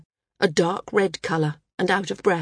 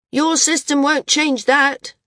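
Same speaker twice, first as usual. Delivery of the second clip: loud, slow, higher shout from the crowd; heckler's shout; 7 semitones higher, 4 dB louder than usual. A narrator's reading. A flyer in the crowd shouting down Maris, loud slow heckle. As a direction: call it out over the assembly. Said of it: "someone shouted".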